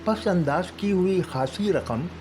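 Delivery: usual speaking voice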